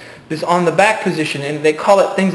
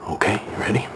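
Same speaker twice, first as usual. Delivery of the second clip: ordinary talk, nothing marked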